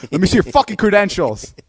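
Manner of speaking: hammed-up FBI interrogation; FBI agent. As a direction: demand it like a cop at the door. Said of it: "as if he was the F B I".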